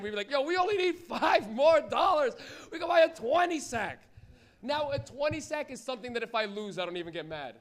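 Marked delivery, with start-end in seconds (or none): 0.3-4.0 higher, louder and slow with hope; mock teenage excitement; excited teen. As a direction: pitch it like the best idea. Teen friends pooling money, excited.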